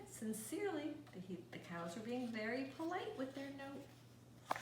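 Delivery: plain reading voice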